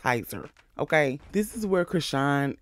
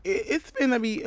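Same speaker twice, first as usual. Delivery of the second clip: mock hopeful voice; 10 semitones higher than usual. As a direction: pretend to be naively hopeful in a tiny voice. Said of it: "I'm like".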